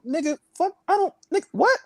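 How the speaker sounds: high, put-on huffy retort; mock indignant. Comic voicing a man told off about a porta potty, high mock huff. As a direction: act out the guy caught leaving a mess. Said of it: "you're like".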